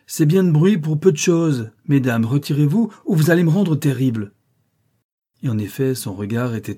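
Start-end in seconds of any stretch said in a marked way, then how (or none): 0.1-4.3 raised, higher, commanding voice; exclaiming. A narrator's reading. Rochester orders the ladies away, exclaiming with a threat. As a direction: order them off with a loud warning. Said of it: "s'écria-t-il".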